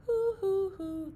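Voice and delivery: put-on sing-song hoo hoo for children; owl hoots. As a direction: hoot like a cartoon owl for kids. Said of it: "The owl in the tree says".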